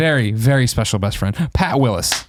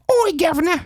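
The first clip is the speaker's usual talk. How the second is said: high-pitched hammed-up British holler; mock British accent. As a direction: call out in a cartoon British accent. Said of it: "In B T W".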